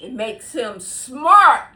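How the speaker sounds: mock president. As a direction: drag it out to mock his boast. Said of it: "What did, uh, our dear president say?".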